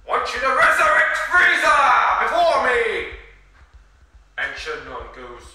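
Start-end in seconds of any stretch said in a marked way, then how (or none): 0.1-3.1 high-pitched, full-volume villain's order; shouted demand. Acted. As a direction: yell the command at the top of your voice. Sorbet's demand to the dragon acted.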